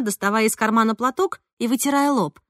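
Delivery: ordinary delivery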